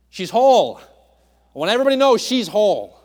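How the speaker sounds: loud, high proclamation; proclaiming. Real, not acted. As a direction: shout it out to the whole room, fast. Preacher proclaims healing.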